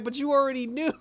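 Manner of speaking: high, loud comeback; mock-exasperated reply; teasing retort. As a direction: needle him with a grin. Own retort to Jimmy.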